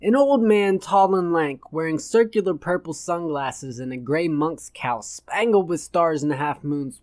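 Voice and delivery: ordinary delivery, nothing marked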